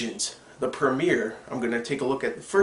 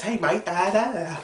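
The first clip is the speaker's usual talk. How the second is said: higher-pitched imitation of the actor's everyday talk; Liam McIntyre; 6 semitones higher than usual. Reviewer showing how the actor really talks in interviews, higher imitated voice. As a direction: lightly mimic his interview voice, pitched up. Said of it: "he talks like this".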